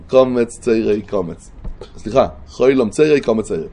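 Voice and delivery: usual speaking voice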